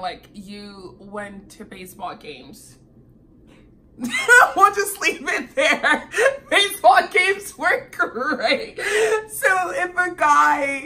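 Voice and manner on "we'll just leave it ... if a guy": loud, high, slow through real laughter; laughing. Real, not acted. Woman dropping the baseball games subject, laughing hard. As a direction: struggle to talk while cracking up.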